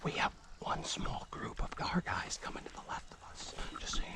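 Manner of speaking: hushed whisper to the team. Real, not acted. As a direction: keep it barely breathed, hidden. Quiet radio update mid-game.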